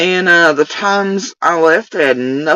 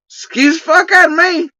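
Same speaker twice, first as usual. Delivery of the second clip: indignant; 13 semitones higher than usual. Real, not acted. Indignant at accusation.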